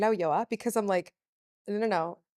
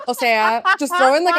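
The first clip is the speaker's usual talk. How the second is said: louder, higher animated advice; excited; 6 semitones higher, 11 dB louder than usual. Real, not acted. Urging her friend to drop slang, excited and loud. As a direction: push the slang with gusto.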